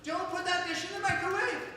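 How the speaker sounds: very high-pitched mock wife; imitated excited nagging in falsetto. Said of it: "gets a little excited and raises her voice".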